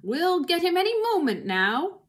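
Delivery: high, loud triumphant call; shouting. A narrator's reading. Boggis to Bunce and Bean, high confident shout. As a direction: call it across the digging. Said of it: "shouted to Bunce and Bean".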